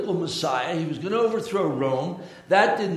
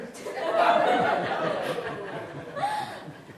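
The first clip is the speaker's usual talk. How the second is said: laughing at the joke. Real, not acted. Laughs after punchline.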